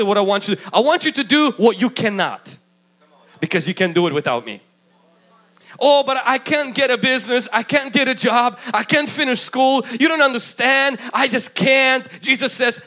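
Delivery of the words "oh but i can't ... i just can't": mock complaint, pitched up in insistent protest; higher-pitched string of objections; protesting excuses. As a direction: play the believer pleading inability. Preacher acts out protesting excuses.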